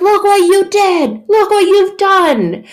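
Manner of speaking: high, loud, drawn-out accusation; hammed-up outraged family; shouted blame. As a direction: yell it slowly at the culprit. Family blames over spilt milk.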